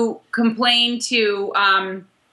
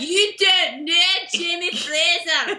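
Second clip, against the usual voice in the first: playful hammed-up Scottish accent, raised pitch; mock Scottish accent; 4 semitones higher than usual. Co-host butts in as the rival, mock Scottish accent. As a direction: tease in a bad Scottish brogue.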